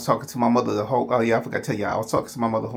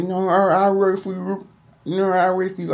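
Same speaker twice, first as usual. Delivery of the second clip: higher, slurred pleading; imitating slurred speech; 8 semitones higher than usual. Husband on phone to wife, imitating his own slurred, higher reply. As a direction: mimic his stroke-slurred answer to his wife. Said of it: "I was talking like that".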